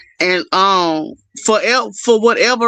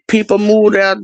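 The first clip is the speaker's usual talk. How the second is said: ordinary talk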